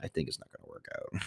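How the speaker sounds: whispered breakup line. Retold or imitated. Telling the girlfriend it's over, hushed whisper. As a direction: let the breakup slip out under your breath. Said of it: "I'm like".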